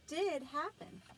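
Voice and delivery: plain reading voice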